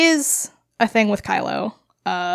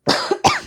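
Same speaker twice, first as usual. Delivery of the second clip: choking cough on water; sputtering cough. Real, not acted. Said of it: "water went down the wrong way".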